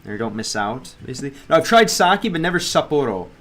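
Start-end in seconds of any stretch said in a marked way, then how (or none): 1.5-3.3 loud and high, blurting it out; loud excited. Real, not acted. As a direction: shout it out excitedly. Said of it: "is, was what I was saying".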